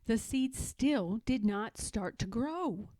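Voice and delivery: ordinary delivery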